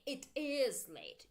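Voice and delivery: slow, "is" lifted and drawn out; emphasis by intonation on "is"; stress on IS. Shown as a demonstration. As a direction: punch IS. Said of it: "You make emphasis with your intonation".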